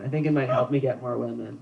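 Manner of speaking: ordinary talk, nothing marked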